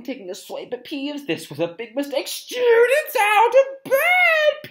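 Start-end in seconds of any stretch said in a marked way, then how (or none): 2.3-4.7 high, drawn-out bellow. Acted. Peeves alerting Filch to the children, slow high bellow. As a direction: raise the alarm at full shriek. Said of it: "Peeves bellowed".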